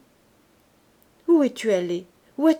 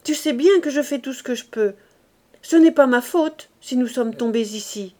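louder, higher indignant protest; growing heated; 4 semitones higher, 6 dB louder than usual. A narrator's reading. Wife protesting to her partner, louder and indignant. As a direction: push back hotly at last. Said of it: "dit-elle en s'animant".

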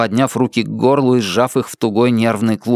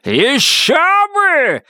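higher, slow roar; furious yell; much slower than usual. A narrator's reading. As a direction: yell it back at her. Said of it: "заорал он в ответ".

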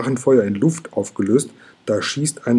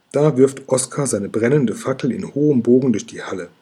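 ordinary delivery

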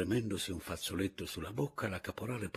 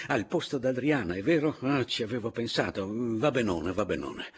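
higher, louder, cutting in; eager interrupting; 5 semitones higher, 6 dB louder than usual. A narrator's reading. Papiano interrupts the caporale about the seating, eager interruption. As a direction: jump in briskly, then settle.

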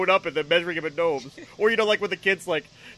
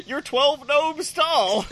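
very slow, giggling through it; laughing dad; 7 semitones higher, much slower than usual. Pretend. Dad announcing son's height, laughing slowly.

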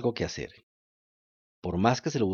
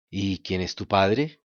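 slow low dolphin voice; 4 semitones lower, slower than usual. Acted. Dolphin asks calmly.

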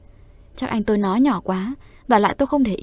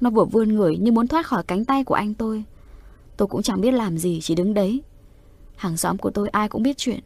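plain reading voice

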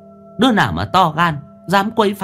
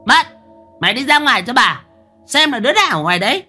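bossy order; 13 semitones higher, 3 dB louder, faster than usual. A narrator's reading. Mistress orders the maid out.

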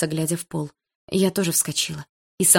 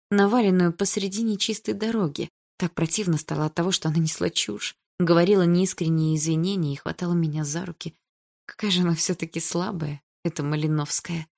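ordinary delivery, nothing marked

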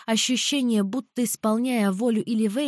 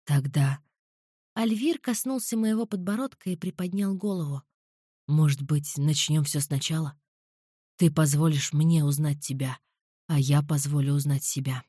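lower, darker man's voice; low male voice; 6 semitones lower than usual. A narrator's reading. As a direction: voice him low and calm. Alvir proposes.

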